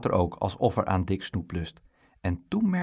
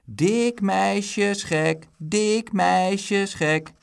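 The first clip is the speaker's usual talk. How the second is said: slow, high sing-song chant, louder at the end; drawn-out schoolyard jeer, building; 10 semitones higher, 5 dB louder, much slower than usual. A narrator's reading. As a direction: chant the taunt, stretching every word. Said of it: "jouwde het Bruin".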